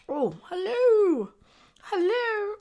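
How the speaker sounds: a hammed-up British hello; fake British accent. Greeting the chat in a mock British accent.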